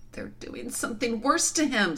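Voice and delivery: put-on high, anxious girl's voice; distressed girl. A narrator's reading. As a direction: dread something dreadful happening. Horrified girl fears for Aslan.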